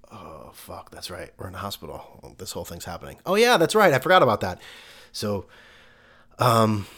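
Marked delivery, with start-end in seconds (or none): 0.1-3.2 much softer, low muttering; muttered realization. Retold or imitated. Father realizing quietly that this is really happening. 3.3-4.6 higher and louder, sarcastic; loud mock realization. Father mocking himself loudly for forgetting the hospital.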